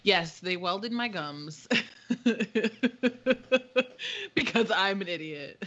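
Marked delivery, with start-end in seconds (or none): 4.3-5.6 laughing through the words. Real, not acted. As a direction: chuckle it out. Laughing at her own mishap.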